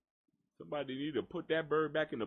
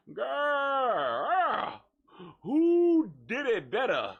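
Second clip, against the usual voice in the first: louder, higher wailing question; mock cry; 7 semitones higher, 5 dB louder, much slower than usual. Asking viewers to compare the two, mock wail. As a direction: cry out who did it better. Crying out, far voice.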